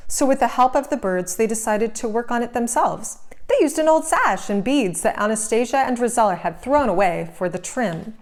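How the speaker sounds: ordinary delivery, nothing marked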